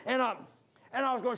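ordinary delivery

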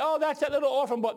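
high, loud imitated bystander; innocent explaining. Bystander tells the boy's mother who he is, high put-on voice.

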